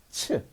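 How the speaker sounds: "츠" as a short isolated CH sound. Shown as a demonstration. Teacher quizzes learners and answers, short "츠". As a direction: sound out CH briefly as "츠". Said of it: "이게 뭐다?".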